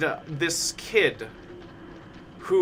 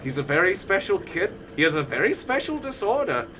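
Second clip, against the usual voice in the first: higher-pitched mock doctor documenting a case; earnest doctor; 4 semitones higher than usual. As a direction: explain it like a concerned specialist, imitated. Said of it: "even the doctor who was".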